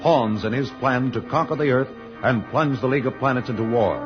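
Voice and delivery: ordinary delivery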